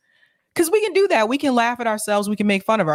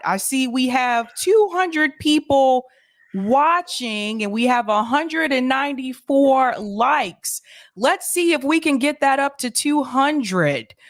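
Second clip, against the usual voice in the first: calm and slow; 2 semitones higher, slower than usual. Retold or imitated. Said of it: "He start out real calm".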